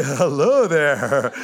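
slow, lightly voiced old elder; a hint of a frail old man saying hello; old elder greeting. A hint of an elderly elder greeting the young hippie.

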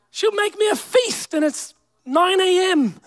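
ordinary talk

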